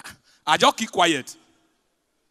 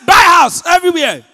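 higher, loud ad-style cry; mock hype shout; 11 semitones higher, 14 dB louder than usual. Enhancer sellers hyping their product, loud mock shout. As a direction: bellow it like a pushy advert. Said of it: "Then they say".

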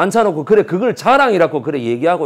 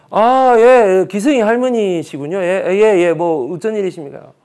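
higher, louder eager phone greeting; polite teacher on phone; 5 semitones higher, 6 dB louder than usual. Retold or imitated. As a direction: fawn over the caller. Teacher answering grandma's call.

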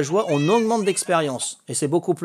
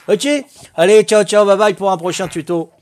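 cheerful goodbye; 4 semitones higher, 7 dB louder than usual. Real, not acted. Cheerful sign-off to viewers.

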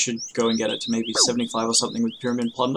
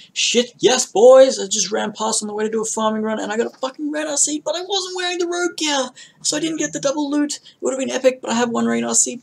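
excited yelling; 12 semitones higher, 3 dB louder than usual. Real, not acted. Rare seed drop, celebrating.

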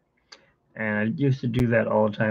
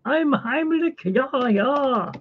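put-on high German caterpillar accent, very high; squeaky chubby caterpillar with a German lilt; high excited Heimlich voice; 13 semitones higher, much slower than usual. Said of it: "Heimlich, how do you look?".

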